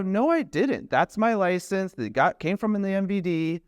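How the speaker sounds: retold protest, pitched well up; mock-outraged, pleading innocence to the officer; indignant denial.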